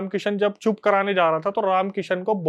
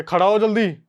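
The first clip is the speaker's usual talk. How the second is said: mild mock yell; retold shout; 3 semitones higher, 3 dB louder than usual. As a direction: imitate an urgent shouted order, half-hearted. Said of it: "वो चिल्ला के बोलता है". (हल्के से चिल्लाकर हुक्म की नकल)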